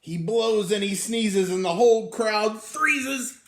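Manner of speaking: loud and high, building drama; dramatic telling. A narrator's reading. Reading the sneeze scene, loud and high. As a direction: boom it out to the children.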